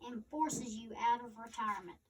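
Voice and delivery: ordinary delivery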